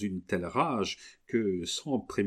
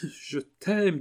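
high, passionate declaration; 9 semitones higher, 3 dB louder than usual. Acted. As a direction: pour all passion into it. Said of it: "l'expression la plus passionnée". (déclaration passionnée, aiguë)

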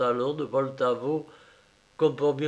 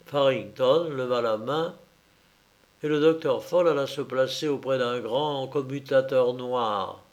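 ordinary delivery

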